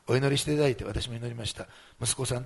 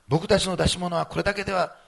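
put-on boy's proclamation; 6 semitones higher, 3 dB louder than usual. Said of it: "今まで見たこともないような大きな声で言いました".

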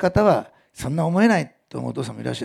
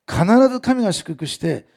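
higher, louder, slow proclamation; preacher proclaiming blessing; fervent preaching; 7 semitones higher, 8 dB louder than usual. Acted. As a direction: declare it with full conviction.

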